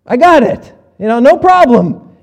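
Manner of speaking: high, loud, dismissive listener's challenge; smug skeptic voice. Pretend. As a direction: play the doubter who thinks he's safe. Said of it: "you maybe are thinking the same thing".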